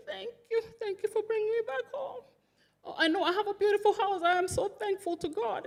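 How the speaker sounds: high, tear-choked thanks, voice near breaking; reliving his weeping gratitude on coming home; retold tearful thanks. Husband thanking his sister for bringing him home, tearfully. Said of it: "he was crying. He was wailing".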